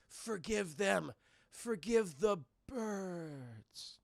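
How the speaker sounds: very slow, fading croak; hammed-up deathbed plea, trailing away; dying last words. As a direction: drag out the final breath.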